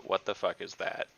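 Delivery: ordinary talk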